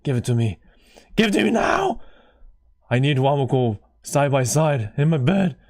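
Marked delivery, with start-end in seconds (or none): 1.1-2.0 high, loud demand; demanding shout. Real, not acted. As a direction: shout it like you crave it. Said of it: "that sounded weird".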